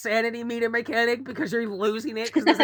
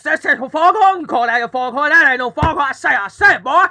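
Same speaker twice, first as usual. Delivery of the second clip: loud, high Southern cartoon rooster; booming, scolding Southern rooster; Foghorn Leghorn; 5 semitones higher, 9 dB louder than usual. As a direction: bluster with zest, full volume. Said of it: "Foghorn Leghorn is not a timid man".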